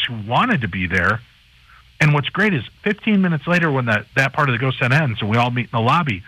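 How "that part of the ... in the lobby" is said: ordinary talk, nothing marked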